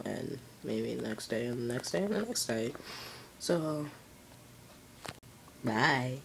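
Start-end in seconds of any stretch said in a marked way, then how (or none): none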